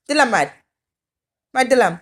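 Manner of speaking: loud pretend threat to smack; mock threat. To the stubborn pet on the bed, a loud playful threat. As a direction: threaten loudly but only in play. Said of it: "মার দেওয়ার কথা বলে".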